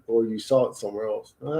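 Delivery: ordinary delivery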